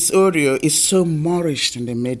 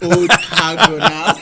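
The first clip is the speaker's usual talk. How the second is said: laughing; 2 semitones higher, 4 dB louder, slower than usual. Real, not acted. Joking about own age.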